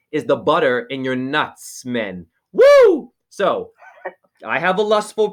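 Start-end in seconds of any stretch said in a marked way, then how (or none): 2.5-3.1 loud, high whoop of glee; excited whoop. Real, not acted. Host whoops after his peanut butter joke, loud and high.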